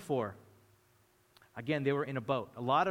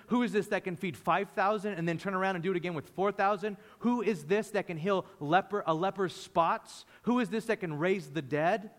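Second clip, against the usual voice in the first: preaching climax; 3 semitones higher than usual. Acted. Preaching the repeated question.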